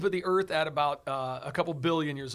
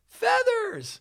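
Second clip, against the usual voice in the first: high-pitched, louder single-word reveal; mock-scandalized high exclamation; dramatic reveal; 5 dB louder than usual. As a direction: pop the word out high and loud. Dramatic reveal of the shocking detail.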